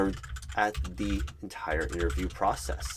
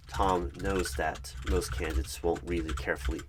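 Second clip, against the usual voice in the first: ordinary delivery, nothing marked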